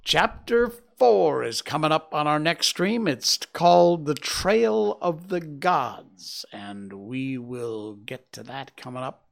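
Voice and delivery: ordinary delivery, nothing marked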